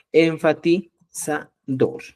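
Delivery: "Enfatizador" silabeado muy lento; dictado lento. Shown as a demonstration. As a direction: alarga "Enfatizador" como al dictar. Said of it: "el enfatizador". ("Enfatizador" dicho muy despacio)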